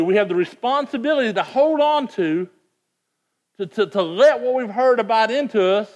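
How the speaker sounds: rising fervent pulpit delivery; preaching at full force. Acted. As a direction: build it like a revival preacher. Preaching climax, raised pitch and volume.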